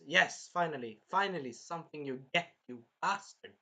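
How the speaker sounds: ordinary talk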